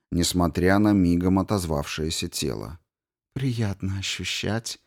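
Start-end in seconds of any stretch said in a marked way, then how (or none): 3.3-4.8 purring. A narrator's reading. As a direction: purr it softly to him. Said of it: "мурлыкнула она".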